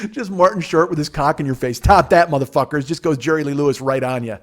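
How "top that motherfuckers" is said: rowdy comic challenge; taunting yell. Retold or imitated. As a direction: yell it at the crowd like a showman. Repeats Martin Short's taunt louder.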